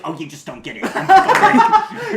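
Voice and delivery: mock-superior "I'm sorry" to people who don't get it; snobby superfan. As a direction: play the condescending know-it-all fan. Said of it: "he'd be like".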